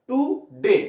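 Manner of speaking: টু, pause, ডে; টু ডে cut apart with a clear gap; split into two syllables. Shown as a demonstration. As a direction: say টু and ডে as two separate beats. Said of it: "কাটা হলো, দুটো হলো".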